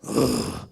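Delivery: low, wordless grunt of a groan; low demo groan; ugh groan. Shown as a demonstration. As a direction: let out a low ugh instead of answering. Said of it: "I go".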